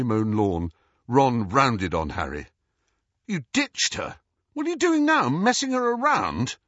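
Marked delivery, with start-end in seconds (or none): 3.3-6.6 high-pitched, indignant challenge; angry accusation. A narrator's reading. Ron rounds on Harry about his sister, angrily. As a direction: fire the questions at him, pitched right up.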